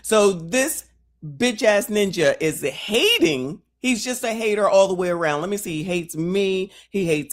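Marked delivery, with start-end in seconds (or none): none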